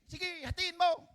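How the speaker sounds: high, slow, uncaring go-ahead; callous consent. Acted. False mother telling the king to cut the baby, cold. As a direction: shrug off the child's fate, drawn out. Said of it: "sabi nung hindi may anak".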